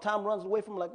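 usual speaking voice

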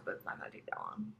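quick whispered aside; whispering. Real, not acted. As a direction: mutter it in a whisper. Aside to herself.

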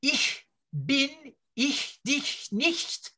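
slow, spaced-out Hochdeutsch German; deliberate Hochdeutsch pronunciation sample. Shown as a demonstration. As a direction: demonstrate careful High German, word by word. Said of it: "speaks Hochdeutsch".

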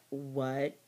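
low, hushed "what"; stunned disbelief. Retold or imitated. Wife hearing the marriage is over, stunned disbelief. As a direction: drop low and quiet, as if stunned.

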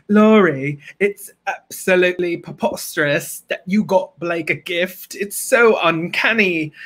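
put-on theatrical diva slipping into British accent; affected, slightly British grande dame; Moira Rose. Impression for Lori drifting British, affected accent. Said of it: "I just turned to British".

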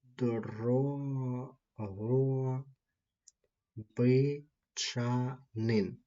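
Дрогобичанин stretched out very slowly, every syllable clear; teacher spelling it out by sound; slow demo pronunciation. Shown as a demonstration. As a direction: model Дрогобичанин slowly for the students. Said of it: "це буде ось так звучати".

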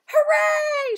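very high, very loud cheer; a whole class hooray; cheering shout. Acted. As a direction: cheer at the top of your lungs. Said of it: "shouted the class".

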